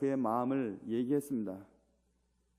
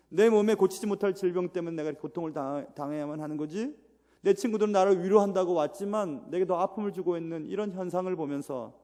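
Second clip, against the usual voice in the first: ordinary talk, nothing marked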